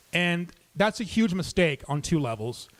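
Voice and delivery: ordinary talk, nothing marked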